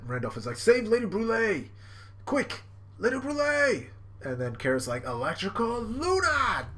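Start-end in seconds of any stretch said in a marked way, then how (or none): 0.6-3.9 high mock-desperate calling; hammed-up panicky minion; urgent rescue plea. Randolph shouts for rescue. 5.0-6.7 high, drawn-out move name; slow pitched-up mock battle cry; attack call. Carrot calls out attack.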